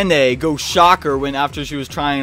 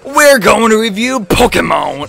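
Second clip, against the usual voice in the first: high, loud YouTuber opening; hyped intro; 9 semitones higher, 6 dB louder, slower than usual. Acted. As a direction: pump up the viewers like a host. Excited show intro greeting.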